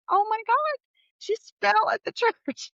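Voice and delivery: high, alarmed cry with a laugh; shocked. Retold or imitated. Family sees mother slip at church, high shocked cry. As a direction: gasp it out in disbelief. Family reacting to mom's fall.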